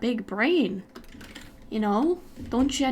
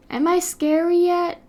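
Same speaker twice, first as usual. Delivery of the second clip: higher playful challenge, pushed up; bigger scary attempt; 6 semitones higher, 4 dB louder than usual. Streamer trying again to be scary, high and playful. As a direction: raise the pitch and push it out at them.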